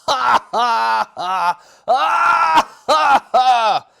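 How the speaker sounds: very high, loud hammed-up villain cackle; terrible giant laugh. Goliath gloating over little David, loud hammed-up laugh. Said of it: "even terrible laugh than usual".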